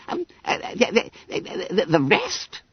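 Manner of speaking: high, halting, stuck on the word; nervous stammer. A narrator's reading. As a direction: stammer it as if cornered. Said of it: "stammered Bunter".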